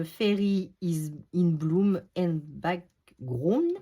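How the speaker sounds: English title in a French accent; poor English accent. Real, not acted. French crafter reading out an English book title, poor English accent. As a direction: slowly attempt English with a heavy French accent. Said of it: "mon accent anglais, c'est pas ça".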